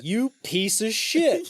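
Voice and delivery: high, drawn-out mock fury; outraged host. Host comes out to his dead dog, outraged. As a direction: spit the insult slowly, hammed-up.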